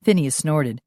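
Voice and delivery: usual speaking voice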